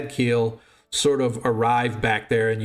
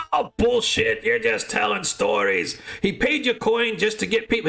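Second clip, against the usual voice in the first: mocking barfly voice, pitched up; a hint of a jeering tavern crowd; scoffing patron; 10 semitones higher than usual.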